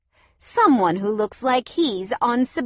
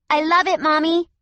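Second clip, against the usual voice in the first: put-on high, louder child's call; little girl calling; 9 semitones higher, 8 dB louder than usual. A narrator's reading. Cassia calling to her mother from down the hallway. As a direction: call out happily like a small child. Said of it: "Cassia called from down the hallway".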